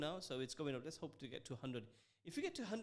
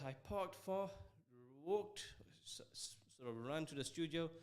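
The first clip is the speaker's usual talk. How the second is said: out of breath. Real, not acted. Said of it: "I'm still catching my breath".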